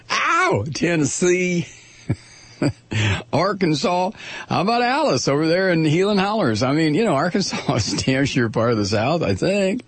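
high, hammed-up Southern twang; mock a Southerner in a high-pitched phony accent. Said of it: "when I adopt that phony-ass Southern accent".

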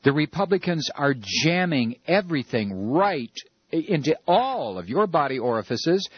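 ordinary talk, nothing marked

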